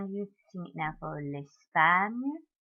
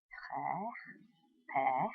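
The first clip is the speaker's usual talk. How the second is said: usual speaking voice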